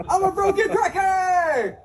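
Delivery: high, slow shouted wail; mock anguished cry. Patient wailing to his therapist in a hammed-up shout.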